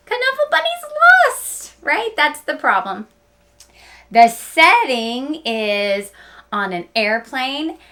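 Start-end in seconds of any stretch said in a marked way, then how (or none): 0.1-2.1 very high, dramatic exclaiming; emphatic question. Real, not acted. Teacher stresses the problem.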